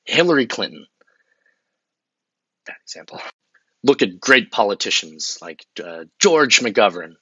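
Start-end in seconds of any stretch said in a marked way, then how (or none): 2.7-3.2 soft unvoiced aside; whispered correction. Realizing his pick was bad, he whispers to himself. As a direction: breathe it as a private note.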